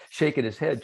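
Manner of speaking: ordinary delivery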